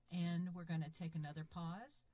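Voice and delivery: the recorded take played back quiet, like from a speaker. Shown as a demonstration. Narrator playing back her own recording, quiet and distant. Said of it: "that's another big one".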